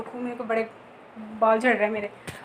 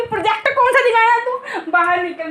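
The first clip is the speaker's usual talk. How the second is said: higher, half-laughing mock scolding from mom; 7 semitones higher, 4 dB louder than usual. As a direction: play mom calling through the bathroom door, laughing. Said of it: "mummy बोलती". (Mummy की हँसते हुए डाँट)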